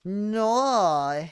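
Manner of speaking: high, loud, drawn-out no; dismayed cry. Real, not acted. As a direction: cry out in protest at the game. Cries out during play.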